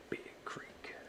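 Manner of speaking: hushed savouring whisper. Shown as a demonstration. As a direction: breathe "Big Creek." like a luxury. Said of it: "Just enjoy that sound".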